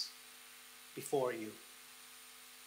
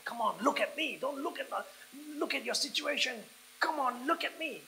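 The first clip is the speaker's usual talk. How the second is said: higher, insistent appeal; urgent coaxing; 9 semitones higher than usual. Acted. Jesus urging the fearful to look at him, insistently. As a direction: urge them on, pitched well up.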